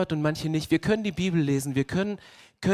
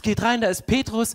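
normal talking voice

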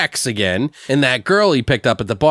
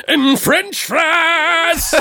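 mock shout; much slower than usual. Retelling the killer's silly line.

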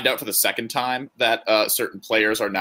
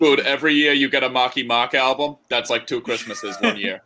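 put-on Boston accent; 3 semitones higher than usual. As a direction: lean hard into a joking Boston accent. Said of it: "I said that in a Boston accent".

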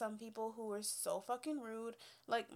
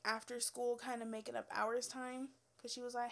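ordinary talk

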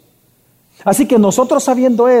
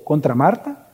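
ordinary talk, nothing marked

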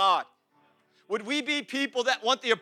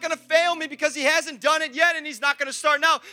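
loud, raised, driving sermon voice; impassioned preaching; 6 semitones higher, 8 dB louder than usual. Real, not acted. Preacher vows to praise God without a band, impassioned preaching. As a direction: preach it at full force, building with each promise.

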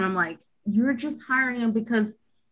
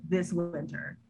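deep, dramatic trailer announcer; movie trailer voice; 6 semitones lower, 10 dB quieter, slower than usual. Retold or imitated. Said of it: "it sounded like".

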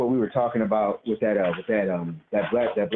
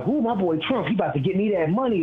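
imitating the dad, pitched up with glee; excited dad; 9 semitones higher than usual. Son imitating his dad excited about Trump, pitched up. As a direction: do your dad gloating about the money. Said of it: "he was all excited".

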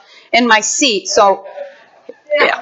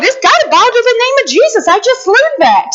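triumphant; 7 semitones higher, faster than usual. Retold or imitated. Retold triumphant reply.

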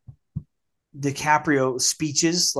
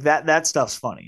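ordinary talk, nothing marked

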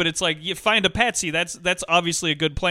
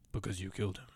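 much lower, soft, mumbled Because you killed him; Depp line reading; 11 semitones lower, 17 dB quieter, faster than usual. Retold or imitated. Quoting Depp's line, mumbled low and quiet. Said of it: "one of my favorite line readings 'cause he goes".

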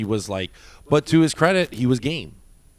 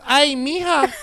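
much higher, sing-song teasing Ay Mija; a doorman's playful high Ay Mija; flirty Ay Mija; 5 dB louder, slower than usual. Retold or imitated. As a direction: swoon the words out high. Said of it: "he touches his chin, he goes".

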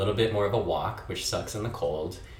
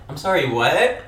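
confused; 7 semitones higher, 9 dB louder than usual. Retold or imitated. Retelling waking her roommate.

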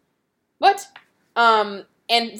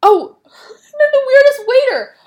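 high, loud outburst through laughter; real laughing, high-pitched exclamation; 14 semitones higher, 10 dB louder than usual. Real, not acted. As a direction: burst out laughing as you remember. Exclaiming about odd waiter, laughing.

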